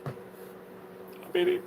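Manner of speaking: breathless whispered whimper. Acted. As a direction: whisper it, slowed right down. Mock whine while eating.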